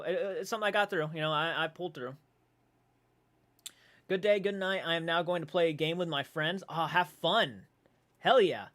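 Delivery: ordinary talk, nothing marked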